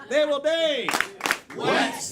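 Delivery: shouted catchphrase; loud. Shown as a demonstration. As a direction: punch out of there loud. Catchphrase chant joke.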